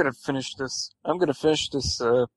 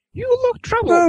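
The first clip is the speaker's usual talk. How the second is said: put-on very high, louder little-girl voice; young girl; 5 dB louder than usual. A narrator's reading. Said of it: "the young girl frowned".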